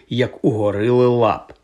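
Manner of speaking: usual speaking voice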